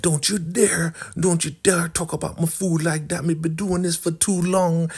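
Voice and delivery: high scolding put-on woman's voice; imitated offended cook, pitched high; mock outraged warning. Elder Mother defends cooking in acted quarrel.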